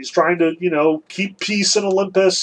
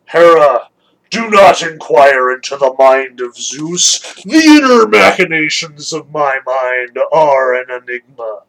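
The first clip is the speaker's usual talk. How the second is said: pompous Zeus; 5 semitones lower, 4 dB louder than usual. Acted. Zeus pulls rank on Hera, pompous and booming. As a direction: proclaim it like an all-powerful king of gods. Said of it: "Zeus is like".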